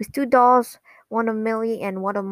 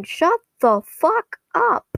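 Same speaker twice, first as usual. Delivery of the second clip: high, drawn-out mock scream; Luna screaming; 8 semitones higher, slower than usual. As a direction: imitate an irritated yell, slow and high. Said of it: "Luna screaming".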